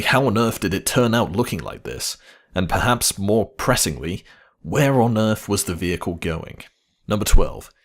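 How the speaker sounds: ordinary talk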